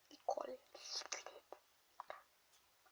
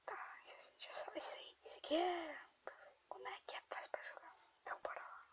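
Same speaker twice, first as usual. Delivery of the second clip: whispering; 5 semitones higher, 8 dB quieter than usual. Real, not acted. Whispers while grandma sleeps.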